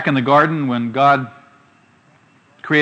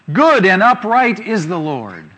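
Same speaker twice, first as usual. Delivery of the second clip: slow, louder reading of the verse; scripture reading; 5 semitones higher, 6 dB louder, slower than usual. Acted. Preacher reads a psalm to the congregation, slow and solemn. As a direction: read the verse out slowly with weight.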